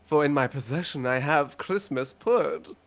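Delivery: ordinary talk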